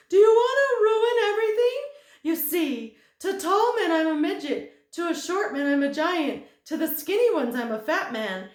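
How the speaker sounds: very high, loud, fast chatter; giddy thin man. Acted. Thin man gushes to Milo, high and fast. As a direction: gush without stopping for breath. Ordinary man explains his trick.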